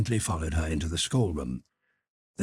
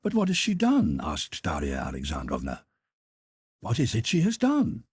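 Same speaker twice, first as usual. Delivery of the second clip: much higher, insistent question; aghast demand; 4 semitones higher than usual. Acted. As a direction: press him, shocked. Dolly demands answers, aghast.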